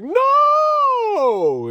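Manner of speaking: crowd shouting no. Retold or imitated. Crowd answers [name]'s question, hammed-up loud high shout. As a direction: belt it out like a whole crowd booing. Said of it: "they was like".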